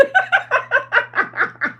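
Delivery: high, loud burst of real laughing; helpless laughter. Real, not acted. Vlogger tickled by her own retold joke, helpless laughter. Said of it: "I couldn't help but laugh".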